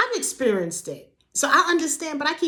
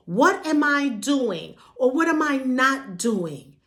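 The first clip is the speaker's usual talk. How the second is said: ordinary talk, nothing marked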